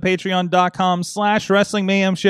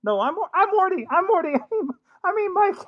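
put-on much higher, stammering nervous teen voice; Morty voice; 14 semitones higher than usual. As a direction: stutter it out in a jittery high pitch. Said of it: "your Morty voice sucks".

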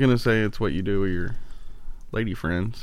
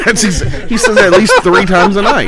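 laughing; 8 semitones higher, 7 dB louder than usual. Real, not acted. Laughing loud at the quote.

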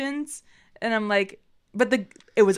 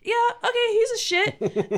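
acted grudging concession; 13 semitones higher than usual. Viewer conceding to the writers about a character, high-pitched grudging. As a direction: give in high, stretching the last words.

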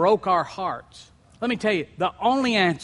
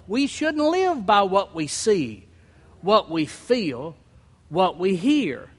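normal talking voice

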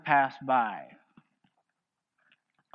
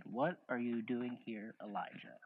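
gentle whisper; 4 semitones lower, 16 dB quieter than usual. A narrator's reading. The Lord asks Elijah in the cave, hushed. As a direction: let the question fall almost silent. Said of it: "the sound of a gentle whisper".

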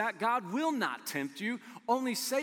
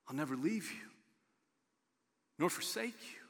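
hushed promise; 6 dB quieter, faster than usual. Acted. Repeats promise, trailing to whisper.

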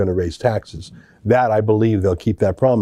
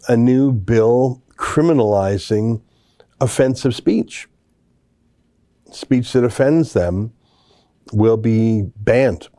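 slow, deliberate emphasis; slower than usual. Real, not acted.

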